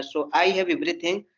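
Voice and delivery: ordinary delivery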